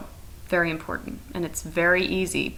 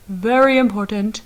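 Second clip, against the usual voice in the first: very, very important said much louder; loud burst; 3 semitones higher, 7 dB louder than usual. Shown as a demonstration. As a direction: burst out after the whisper. Loud burst after whisper.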